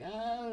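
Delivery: high, falling "ahh" in imitation; mock high-pitched "aah"; retold "ah". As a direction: imitate a friend's long, high, soft noise. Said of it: "he was just like".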